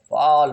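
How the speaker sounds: slow फॉल with a long AW; drawn-out aw pronunciation. Shown as a demonstration. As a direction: stretch the vowel in फॉल. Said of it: "इसको हम फॉल बोलते हैं". ("फॉल" में ऑ को खींचकर)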